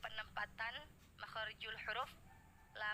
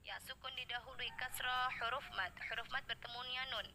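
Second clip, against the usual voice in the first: ordinary talk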